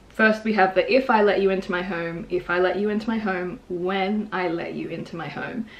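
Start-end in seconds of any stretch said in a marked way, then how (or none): none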